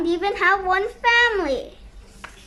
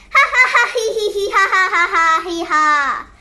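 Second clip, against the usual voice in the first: hammed-up cackle falling in pitch; long acted run of ha-ha and he-he; gloating laugh; 4 dB louder than usual. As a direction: cackle in mock triumph. Character gloats in story.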